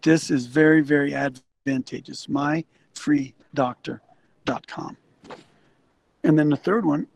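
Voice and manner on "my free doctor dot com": slow, spelled-out address. Shown as a demonstration. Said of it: "repeats web address slowly".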